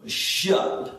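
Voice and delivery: "Shut" squashed into a single 'shup'; "Shut" said as a slurred 'shup'; imitated child's pronunciation. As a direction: mimic a little one running "Shut" up into one syllable. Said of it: "That's how she says".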